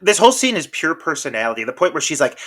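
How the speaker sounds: ordinary delivery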